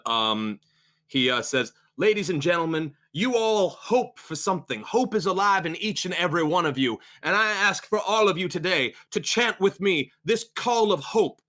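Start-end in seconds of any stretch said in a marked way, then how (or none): 2.0-11.4 high, fervent sermon call; rally the whole congregation to join in; rousing preacher. Acted. Preacher asking everyone to chant with him, fervent rallying.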